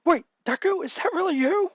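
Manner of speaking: high-pitched cry of disbelief; mock yell. A narrator's reading. Boy recognizing long-lost childhood friend, high astonished yell. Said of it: "as he yells".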